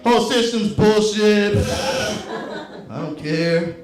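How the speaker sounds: drawn-out bored dismissal; mock cynical. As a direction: shrug it all off like a jaded cynic. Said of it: "pretend I'm really cynical".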